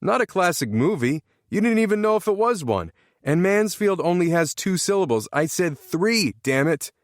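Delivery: high-pitched irritated scolding; exasperated. A narrator's reading. Exasperated player arguing over the rules.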